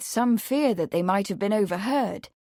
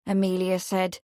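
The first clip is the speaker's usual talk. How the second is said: usual speaking voice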